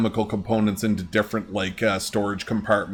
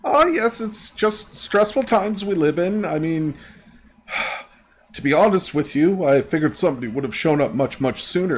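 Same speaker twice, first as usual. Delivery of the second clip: high-pitched, stressed secretary voice; acted nervous clerk under strain; fidgety secretary; 8 semitones higher, 3 dB louder than usual. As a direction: start high and edgy, easing down as you confide. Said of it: "she seems a little fidgety".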